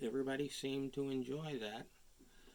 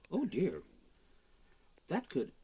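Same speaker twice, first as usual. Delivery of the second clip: plain reading voice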